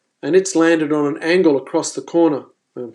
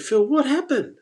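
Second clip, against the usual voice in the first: mild mock astonishment, pitched up; baffled retold question; 9 semitones higher, 4 dB quieter than usual. Shaken angler asking Phil about the shark, baffled question.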